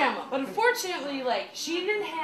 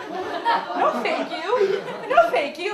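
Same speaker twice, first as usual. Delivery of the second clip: laughing refusal; 8 semitones higher, 6 dB louder, much slower than usual. Real, not acted.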